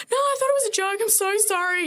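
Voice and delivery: high, loud, apologetic professional voice. Retold or imitated. Nurse apologising to the High Commission caller, high and flustered. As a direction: put on your best polite work voice. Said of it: "put on my most, like, professional".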